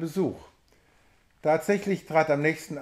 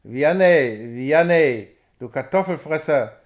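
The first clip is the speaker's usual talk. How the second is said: loud, drawn-out groaning call from the grave; loud ghostly taunt; sepulchral groan; 6 dB louder than usual. A narrator's reading. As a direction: groan it out like a menacing voice from the tomb. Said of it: "Eine Grabesstimme ächzte".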